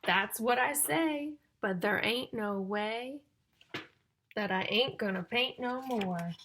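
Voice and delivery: loud, slow, sing-song storybook reading; reading to children. Acted. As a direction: build suspense before each rhyme. Reading rhyming picture book to children.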